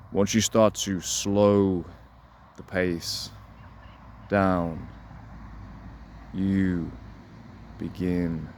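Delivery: slow, soft, drawn-out demo; slowed-down delivery. Shown as a demonstration. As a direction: ease the pace right down to calm her. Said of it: "I'm not gonna do a whole hypnotic thing on you".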